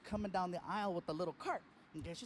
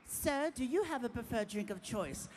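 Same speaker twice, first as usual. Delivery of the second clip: lightly voiced German flight attendant; a hint of an airline hostess. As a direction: imitate a polite cabin attendant. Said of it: "then she looks at Martin".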